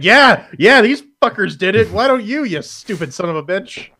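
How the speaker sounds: loud, high, hammed-up outrage; play-acted scolding, voice jumping up high; mock indignant rant. Acted taunt at other developers.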